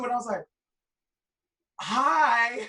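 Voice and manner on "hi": loud, high, awkward starstruck hi. Retold or imitated. Fan greets [name] in the store, loud awkward hi. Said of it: "I got really awkward".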